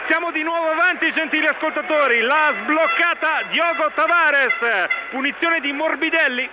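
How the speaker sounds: high, excited recap after the goal; breathless italian goal commentary. Real, not acted. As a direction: still buzzing from the goal.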